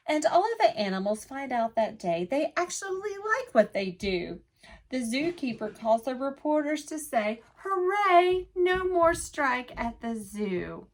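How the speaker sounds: lively picture-book read-aloud in rhyme; sing-song storytime voice for little listeners; reading to children. A narrator's reading. Teacher reading a rhyming book to children, lively.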